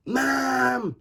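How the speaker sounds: put-on high excited girl calling. Little girl calling to her mother, excited. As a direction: call out to mom thrilled. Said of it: "she cried".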